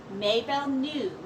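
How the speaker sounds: usual speaking voice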